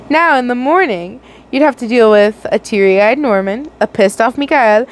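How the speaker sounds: usual speaking voice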